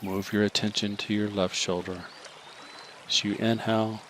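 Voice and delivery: ordinary talk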